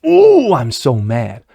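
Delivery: slow, hammed-up fake indignation; mock outrage. Host mocks Republicans' staged outrage, slow and hammed-up. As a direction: lampoon a politician pretending to be mad. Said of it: "gonna come out and say".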